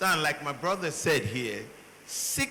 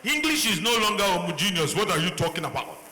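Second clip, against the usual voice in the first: a hint of his African English, pushed up and louder; 2 semitones higher, 4 dB louder, faster than usual. Speaker retorting to Americans, lightly voiced African English. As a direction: lightly slide into African English to argue back. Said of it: "my real African English is gonna come".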